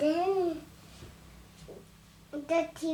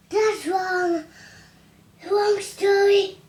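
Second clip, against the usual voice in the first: louder, higher self-correction; frustrated correction; 4 semitones higher, 8 dB louder than usual. Real, not acted. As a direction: scold yourself for the wrong story. Child corrects her own story.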